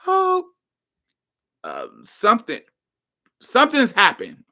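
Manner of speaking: high, halting mock tearful voice; imitated crying. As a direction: act out a tearful friend, words breaking up. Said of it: "she's crying like".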